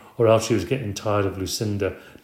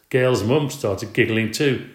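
usual speaking voice

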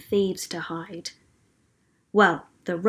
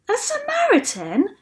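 very high, incredulous question; shocked cry; 14 semitones higher than usual. A narrator's reading. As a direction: exclaim in disbelief, rising at the end. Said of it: "shouted someone".

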